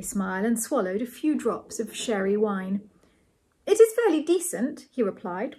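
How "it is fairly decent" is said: bashful. A narrator's reading. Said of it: "with a bashful glance at Ethel".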